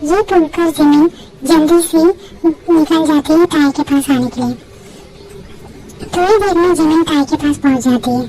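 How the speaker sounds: ordinary talk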